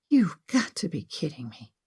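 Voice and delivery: whispered disbelief; soft, airy whispered complaint; exasperated whisper. A narrator's reading. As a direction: whisper it under the breath, fed up. Woken by phone ringing at night.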